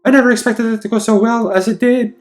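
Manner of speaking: high, loud triumphant woman's voice; gloating Anna. Acted. Anna reading her diary aloud, high gloating. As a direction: crow over it in her sweet fake voice.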